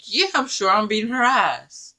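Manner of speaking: high mock-tough promise; defiant vow. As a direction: fire it back sure of yourself. Vowing revenge, retold.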